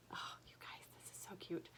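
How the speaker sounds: gushing in a soft whisper. Real, not acted. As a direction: breathe it out, adoring. Gushing over a cute finished stitch.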